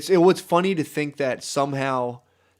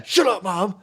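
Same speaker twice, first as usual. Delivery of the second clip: higher, bratty outburst; mock tantrum; 6 semitones higher, slower than usual. Grown son talking back to mom, hammed-up tantrum. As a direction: play a son yelling back at his mother.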